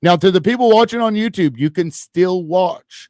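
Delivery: high, loud broadcaster announcement, slowing; announcer voice. Acted. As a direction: announce it to the viewers. Addressing YouTube viewers.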